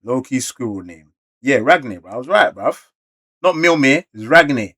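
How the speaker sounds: usual speaking voice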